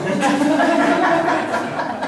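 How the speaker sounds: high, loud burst of laughter; loud laugh at his own mock rant; real laugh. Real, not acted. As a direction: break up laughing at the joke.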